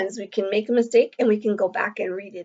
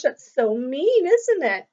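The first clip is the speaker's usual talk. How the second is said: usual speaking voice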